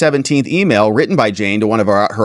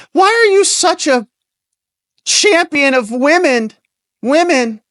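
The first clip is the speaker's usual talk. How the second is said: very high-pitched mock interviewer voice; Jen; slower than usual. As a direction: put on a high voice, mocking her question. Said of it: "here's Jen. She's like".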